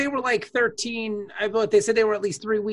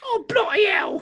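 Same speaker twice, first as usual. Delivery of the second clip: put-on British accent; 10 semitones higher than usual. Exasperated at the group chat, shouted British accent. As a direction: cry it like an exasperated Brit. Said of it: "did not sound like [name]'s voice".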